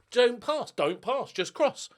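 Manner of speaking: high, emphatic orders to a player; mock instructions. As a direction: bark the tactics up high. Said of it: "There you go, fixed it".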